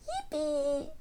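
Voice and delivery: very high, soft-volume squeal of joy; storybook cheer. A narrator's reading. Peppa cheering about puddles, high squealed mock shout. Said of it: "Peppa shouts".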